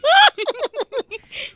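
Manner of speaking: high squeaky raspy laugh; Zacarias laugh. Retold or imitated. Ends the Zacarias voice message. (gargalhada aguda e rouca)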